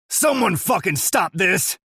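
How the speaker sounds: loud, high desperate bark; barking shout. A narrator's reading. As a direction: yell the order in panic. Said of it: "the sheriff barks".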